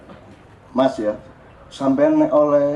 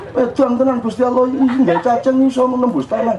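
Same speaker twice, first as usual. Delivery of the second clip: put-on much higher, excited little boy marvelling at a worm; child's amazed reaction; 8 semitones higher than usual. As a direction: gasp in wonder like a four-year-old.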